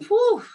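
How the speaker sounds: high, loud whew; big breath out. Retold or imitated. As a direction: imitate a relieved breather's sharp exhale. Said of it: "when you said breathe".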